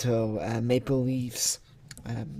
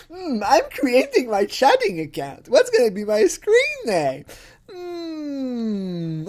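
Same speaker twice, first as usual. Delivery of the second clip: higher, pondering dopey guy; 11 semitones higher, 5 dB louder than usual. Acted. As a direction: think it over like a dim guy. Imitating guy picking name.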